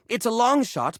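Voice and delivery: normal talking voice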